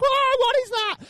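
very high startled yelp; freaked-out shout. Acted. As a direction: blurt it out in mock panic. Imagines himself freaking out at figure.

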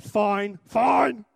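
loud, rising mock-defensive answer; hammed-up forceful denial; insistent fine. Snappy false answer about wife.

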